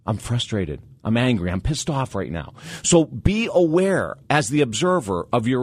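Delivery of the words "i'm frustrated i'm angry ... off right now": calm self-observing voice. Shown as a demonstration.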